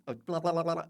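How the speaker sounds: ordinary delivery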